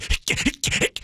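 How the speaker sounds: breathy strained grunts; gasping against the g's; under heavy g force. Retold or imitated.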